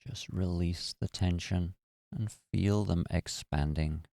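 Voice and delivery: calm, even meditation voice with long pauses; guided meditation. Acted. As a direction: guide listeners gently through relaxing the body. Leads a guided body-relaxation meditation.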